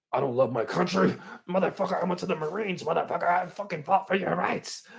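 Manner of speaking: loud, high indignant rant; acted furious outburst; outraged cop. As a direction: bellow it like an offended veteran. Cop outraged at patriotism jab.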